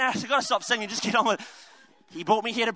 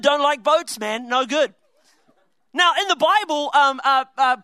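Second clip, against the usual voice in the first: ordinary delivery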